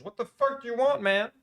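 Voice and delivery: real frustrated yell across the room; angry yell. Real, not acted. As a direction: shout it out angrily at him. Yelling at the generator guy.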